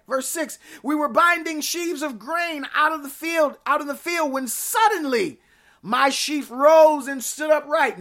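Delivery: high, ringing pulpit delivery, phrase after phrase; scripture declaimed. Real, not acted. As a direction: proclaim the Bible story with urgent force. Declaims scripture at full force.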